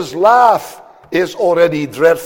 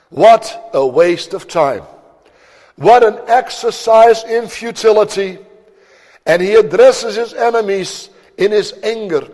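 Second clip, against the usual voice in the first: normal talking voice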